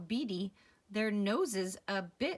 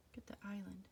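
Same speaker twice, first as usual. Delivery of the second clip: whispering; 10 dB quieter, faster than usual. Real, not acted. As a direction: whisper it to the little listener. Asides whispered to child.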